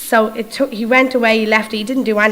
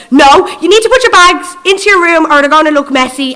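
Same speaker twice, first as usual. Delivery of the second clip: high, loud telling-off; hammed-up hotel clerk giving out; mock desk clerk scolding a guest about bags; 7 semitones higher, 8 dB louder than usual. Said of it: "they're giving out to you".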